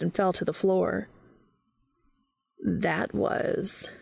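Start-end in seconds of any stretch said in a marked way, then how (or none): 2.6-3.9 "That was," left hanging before a pause; dramatic pause. A narrator's reading. Castiel warning Dean after the pillow throw, "That was," left dramatically hanging. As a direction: hold off after "That was," for effect. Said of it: "paused for dramatic effect".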